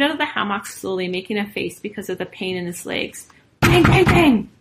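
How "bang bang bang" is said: loud, high storybook banging; forceful, punched sound-effect words; pounding noise. A narrator's reading. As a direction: shout it like fists pounding a door. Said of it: "The girls pounded on the door".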